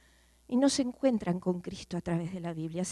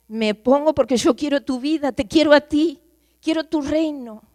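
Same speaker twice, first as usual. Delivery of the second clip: louder, higher, passionate prayer; fervent loud prayer; 5 semitones higher, 11 dB louder than usual. Acted. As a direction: cry out to the Lord in full voice. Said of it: "Dile, "Señor". (oración fervorosa en voz alta)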